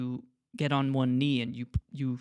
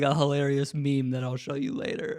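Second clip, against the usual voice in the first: normal talking voice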